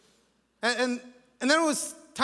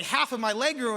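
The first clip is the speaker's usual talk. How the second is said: normal talking voice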